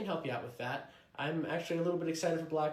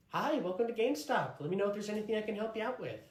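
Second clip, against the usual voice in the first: cheery store greeter; 7 semitones higher than usual. Acted. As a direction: lightly greet a customer at the shop.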